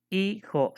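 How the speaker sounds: Hijo with the I held long; stress on I. Shown as a demonstration. Teacher showing where Hijo is stressed, long held I. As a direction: stretch the I of Hijo, then a separate jo. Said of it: "es la I".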